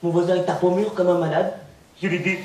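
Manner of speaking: usual speaking voice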